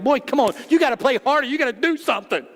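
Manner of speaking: a dad hollering from the bleachers; yelling coach-dad. Retold or imitated. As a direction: push the kid hard from the sidelines. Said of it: "You just yell at me".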